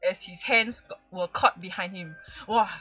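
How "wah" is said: ordinary talk, nothing marked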